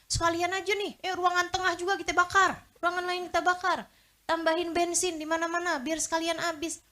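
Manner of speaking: sarcastic imitation of someone fanning the fire; mock eager arsonist. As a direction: ham up the foolish suggestion. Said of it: "terus kamu bilang".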